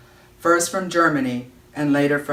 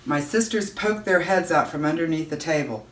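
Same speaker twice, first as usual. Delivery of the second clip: plain reading voice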